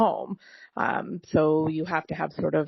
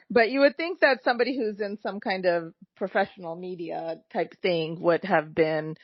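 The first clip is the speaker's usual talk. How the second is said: normal talking voice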